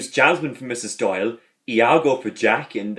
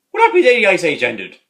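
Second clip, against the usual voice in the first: higher, louder dopey question; hammed-up Dougal impression; 7 semitones higher, 7 dB louder, faster than usual. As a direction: ask it like a clueless young priest. Dougal's question in retold scene.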